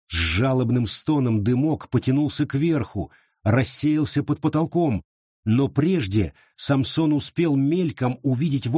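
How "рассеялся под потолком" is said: plain reading voice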